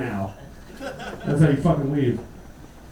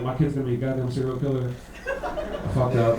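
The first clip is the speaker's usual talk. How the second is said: mock dread; 6 semitones lower, 4 dB quieter than usual. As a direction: sink into it, dropping low. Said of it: "you're like".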